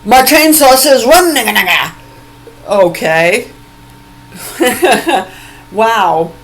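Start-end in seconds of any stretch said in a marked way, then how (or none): 0.0-1.9 higher, louder mock shout, voicing dad; hammed-up loud dad quote. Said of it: "my dad's saying". 4.3-5.3 loud laugh. Real, not acted. Laughs at dad's joke.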